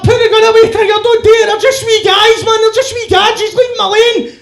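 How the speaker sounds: high, loud, desperate shouting; screaming plea. Acted. [name] convincingly pleads for the wee guys, frantic screaming. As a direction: plead at the top of your voice. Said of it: "he's screaming about a gun".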